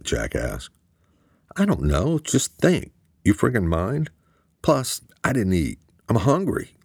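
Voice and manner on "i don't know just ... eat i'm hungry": higher, testy comeback to his brother; irritated snap. A narrator's reading. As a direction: bite back, annoyed and defensive. Luke snaps back at his brother.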